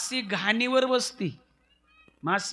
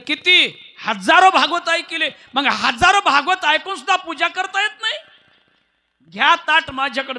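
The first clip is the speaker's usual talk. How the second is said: loud, high, bossy servant woman; the maid scolding the king as she grabs the plate; 7 semitones higher, 10 dB louder than usual. Acted.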